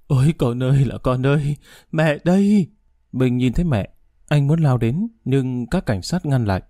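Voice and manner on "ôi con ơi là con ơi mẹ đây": mother's wail, rising to a loud cry at the end. A narrator's reading. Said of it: "gào lên". (tiếng gào khóc của người mẹ gọi con)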